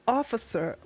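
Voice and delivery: soft, sultry come-on; seductive purr. Retold or imitated. Friend flirting with the masked officer, soft and sultry. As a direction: draw the officer in softly. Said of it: "she begins to feel herself like".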